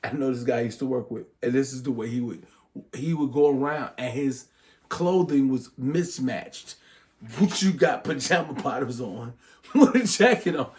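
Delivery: usual speaking voice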